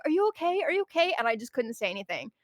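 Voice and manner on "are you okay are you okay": imitated worry, pitched high; quick high-pitched check-in; concerned coworker. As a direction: play an anxious coworker asking. Retold worried coworker.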